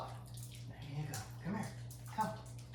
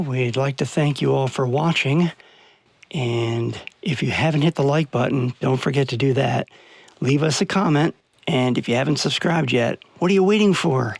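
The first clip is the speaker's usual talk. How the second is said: louder, punchy sign-off to viewers; loud vlog outro; 4 semitones lower, 10 dB louder than usual. Acted. Vlogger thanks viewers and pushes likes in a loud upbeat outro. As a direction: sell the subscribe pitch with energy.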